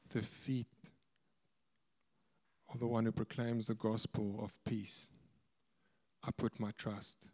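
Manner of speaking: very soft, low and halting; hushed confession. Retold or imitated. Preacher voicing the broken woman's trust in Jesus, hushed and low. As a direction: confide it quietly, with heavy pauses.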